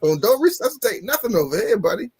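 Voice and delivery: hammed-up anguished refusal to the front desk; laughing mock wail. Refusing resuscitation, laughing cry.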